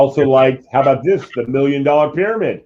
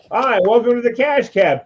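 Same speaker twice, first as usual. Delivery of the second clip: higher-pitched, upbeat host welcome; game show host greeting; 9 semitones higher than usual. Retold or imitated. Cab host to surprised riders, imitated cheery welcome. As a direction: greet new passengers like a TV host.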